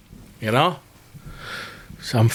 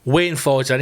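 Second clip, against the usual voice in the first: usual speaking voice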